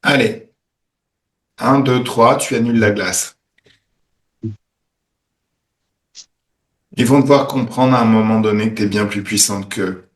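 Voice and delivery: calm them into the scene; guided meditation. Acted. Guides a healing session.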